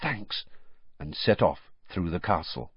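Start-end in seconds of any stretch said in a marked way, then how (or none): 0.0-0.4 hushed, softly whispered thanks. A narrator's reading. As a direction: say it under your breath, much softer. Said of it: "with a whispered".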